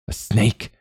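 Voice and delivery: a touch of hissed alarm at a snake. A narrator's reading. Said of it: "he hissed".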